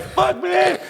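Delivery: high-pitched mock-scared kid. Acting out scared kids at school, mock fright. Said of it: "kids are scared".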